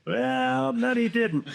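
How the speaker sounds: high, drawn-out mock correction; knowing correction. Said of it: "I'm like".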